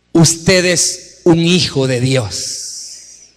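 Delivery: loud, slow, stretched preaching; fiery preacher booming over music; preaching at full force. Acted. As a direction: thunder God's promise over the church. Preaching God's words at full force.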